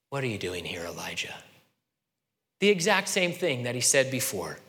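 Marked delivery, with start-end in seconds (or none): 0.1-1.4 quiet, gentle question from God; soft small voice. Acted. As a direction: ask it like a soft whisper from God. God's small voice.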